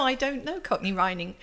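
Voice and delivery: ordinary talk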